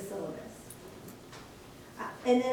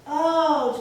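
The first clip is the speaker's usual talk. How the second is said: sudden realisation; 3 semitones higher, 6 dB louder than usual. Retold or imitated. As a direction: act out a student finally spotting it. Student finally finds the course number.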